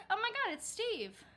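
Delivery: high, loud happy surprise; light up like spotting a friend; high-pitched thrilled greeting; excited recognition. Retold or imitated.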